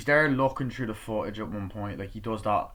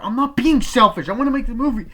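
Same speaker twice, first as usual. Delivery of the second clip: much higher, loud shout; angry yell; 14 semitones higher, 13 dB louder than usual. Retold or imitated. Son shouting back at his father, high and loud.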